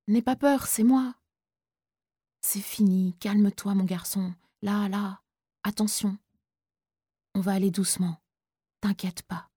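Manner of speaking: soft, tender reassurance; teacher calming the boy, softly; gentle comfort. Acted. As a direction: hush him like a frightened child.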